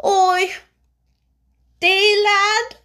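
a mother choked with feeling; tremulous. A narrator's reading. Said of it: "she broke out tremulously".

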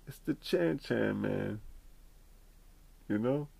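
usual speaking voice

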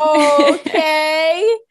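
long rising reluctant okay; drawn-out unsure okay. Retold or imitated. Giving in to the stranger with a drawn-out unsure okay. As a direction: stretch it out, unsure.

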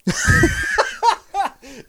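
high-pitched falling laugh; high giggle. Real, not acted. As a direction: giggle at your own joke. Laughs at own joke.

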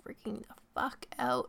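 ordinary talk, nothing marked

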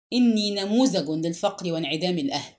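usual speaking voice

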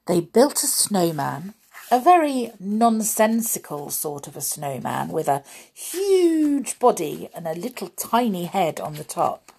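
plain reading voice

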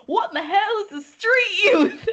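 high, loud, hammed-up Southern twang; deep Southern accent. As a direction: imitate a very Southern friend, laughing through it. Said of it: "in the most deep southern accent".

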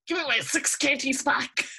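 retold indignant outburst; pissed off. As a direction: demand it back in mock outrage. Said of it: "I used to get so pissed off".

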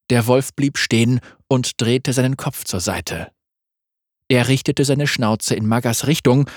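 ordinary delivery, nothing marked

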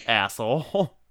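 ordinary talk, nothing marked

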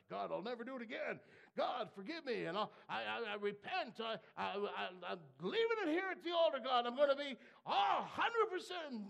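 loud, fast, stammering mock promise to God; imitating desperate vows. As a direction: ham up a frantic altar-call pledge. Mimics a believer's rash altar vows.

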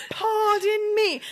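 slow, high-pitched British catchphrase; drawn-out mock English accent; mimicked English mom. Family quoting the mom all trip, mimicked English accent. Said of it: "we were like".

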